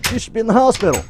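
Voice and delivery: high, loud mock alarm; shocked viewer. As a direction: squeal it like a startled fan. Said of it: "Y'all are probably thinking".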